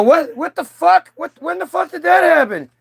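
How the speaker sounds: horrified at seeing the fake video; shocked disbelief. Artist facing blackmail video from label, horrified shock.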